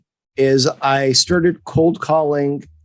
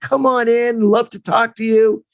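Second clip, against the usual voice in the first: higher, bright mock excitement; mock eager coach; 7 semitones higher than usual. Agent recalls coach's phone eagerness, mock excited. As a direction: play up the coach's fake enthusiasm.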